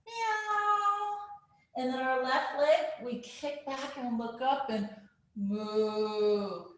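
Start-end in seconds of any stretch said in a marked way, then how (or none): 0.1-1.2 long, high Halloween-cat meow; kitty cat meow. Shown as a demonstration. Teacher rounding the spine like a cat, high meowing. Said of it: "we do the kitty cat". 5.3-6.6 long, low cow moo. Shown as a demonstration. Yoga teacher looking up in cow pose, low mooing. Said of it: "make the moo sound like the cow".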